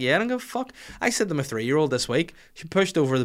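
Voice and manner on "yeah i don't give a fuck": much higher, lightly voiced bratty child; cheeky kid. Dad voicing his kids shrugging off his anger, high and cheeky. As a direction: toss it off like a careless kid. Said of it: "And they're like".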